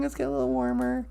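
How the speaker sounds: usual speaking voice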